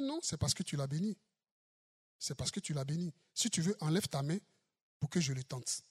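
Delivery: low, hushed, sneering voice of Satan; sly Satan. Acted. The preacher voicing Satan accusing Job, hushed and sly. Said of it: "Il dit".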